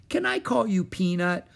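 put-on high, sweet baby talk; little-girl coo. A narrator's reading. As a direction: ask a tiny pet fondly. Annie cooing to the mouse.

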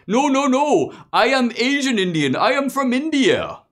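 mock Indian accent, high and loud; a polite immigrant pitching himself, hammed-up high voice; Mister Gadde. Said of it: "imaginative rendition".

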